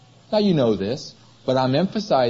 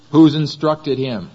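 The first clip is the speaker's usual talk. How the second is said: emphatic reading; 7 dB louder, slower than usual. Real, not acted.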